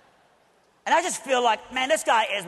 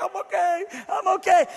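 tearful reassurance; 11 semitones higher, 5 dB louder than usual. Real, not acted. Crying, reassuring onlookers at Wendy's.

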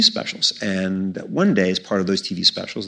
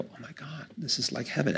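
hushed awe; 6 semitones higher, 11 dB quieter than usual. Retold or imitated. Awed boy in magic shop.

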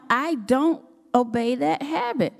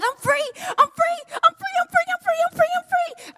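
screaming I'm free. Retold or imitated. Realizing she hasn't smoked all morning, shrieking with joy. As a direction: shout it like a jailbreak. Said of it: "I screamed".

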